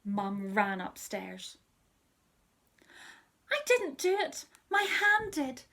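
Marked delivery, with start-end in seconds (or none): 3.5-5.6 put-on high, loud little-kid excuse; whiny excuse. Peter excuses himself to Mum, high whiny child voice. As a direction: plead it like a caught toddler.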